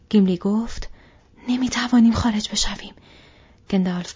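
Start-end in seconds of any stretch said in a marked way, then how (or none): 1.5-2.9 louder, urgent protest; raised alarmed voice. Acted. Gimli tells the company they cannot get out, raised and urgent. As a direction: say it as though the way out is gone.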